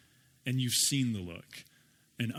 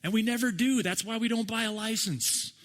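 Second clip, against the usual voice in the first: mock yell back; 8 semitones higher than usual. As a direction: yell back from the boat, joking. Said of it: "And they yell".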